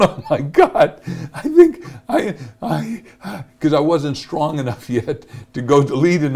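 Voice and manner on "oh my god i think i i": giggling, higher, mock panicked oh my God; laughing panic. Real, not acted. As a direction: laugh through remembered panic. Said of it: "And I'm going".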